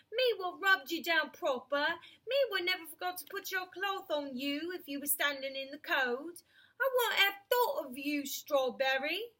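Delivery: loud, high, hurt cockney cabby; indignant cabby voice. Acted. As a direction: protest like a wounded old cabman.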